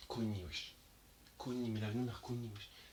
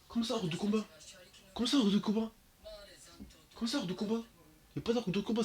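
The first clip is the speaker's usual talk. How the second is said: high, loud indignant protest; can't believe what just happened, protest it; outraged disbelief; 10 semitones higher, 4 dB louder than usual. Real, not acted. Fan shocked his favourite is out of combat, high indignant protest.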